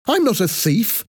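quick, high indignant retort with rising end; angry denial. A narrator's reading. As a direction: protest angrily at normal pace.

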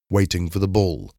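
plain reading voice

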